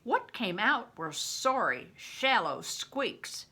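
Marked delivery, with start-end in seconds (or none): none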